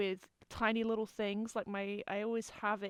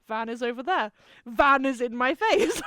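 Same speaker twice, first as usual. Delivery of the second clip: building excitement; 10 dB louder than usual. Retold or imitated. Van flying at her, retold.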